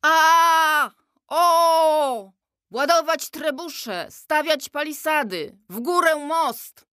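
high, startled battle cries; shouting king. Acted. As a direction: bark orders half asleep, high and loud. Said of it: "pokrzykiwał rozbudzony ze snu król".

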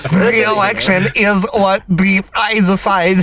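robotic mock Russian with beeps; stilted fake Russian robot accent; weird Russian accent. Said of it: "That is a weird Russian accent".

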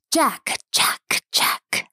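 breathy hiss of the name, trailing off. A narrator's reading. Swamp spirit hissing at the hiding man. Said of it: "the voice hissed".

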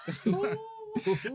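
squealing high laugh, quick; high gleeful laugh. Real, not acted. Laughing at her own gossip about the manager, squealing.

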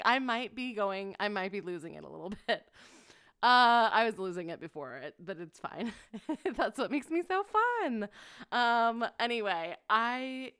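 ordinary talk, nothing marked

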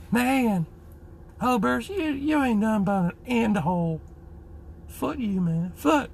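very high mimicking falsetto. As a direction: whine it out in a high-pitched buddy impression. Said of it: "Had a little bit of a high voice".

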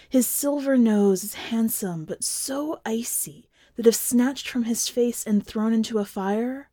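plain reading voice

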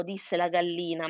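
ordinary delivery, nothing marked

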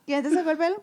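mock mom questioning; mother asking. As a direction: ask it like a surprised mother. Mother asks, voiced in story.

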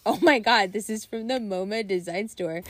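hammed-up imitation of an offended boyfriend; exaggerated boyfriend imitation. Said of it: "he doesn't talk like that".

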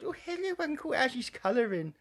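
put-on high little-girl voice; high childlike sing-song greeting; childish girl voice.

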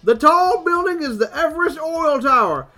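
high, loud tour-guide bellow; hollered riverboat guide announcement. Acted. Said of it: "if you would read for the guide".

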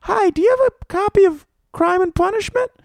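put-on very high falsetto kid voice; little kid. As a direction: pipe up like an eager child. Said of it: "some kid walked in".

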